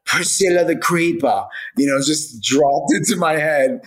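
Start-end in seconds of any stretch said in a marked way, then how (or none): 0.0-1.5 lightly put-on British accent, a bit higher. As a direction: say the name like a posh Brit, half joking. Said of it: "in a British accent".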